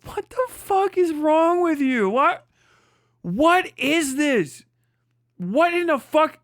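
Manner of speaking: can't believe what he's hearing; incredulous. Real, not acted.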